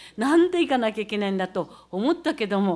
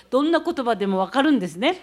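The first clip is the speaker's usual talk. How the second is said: normal talking voice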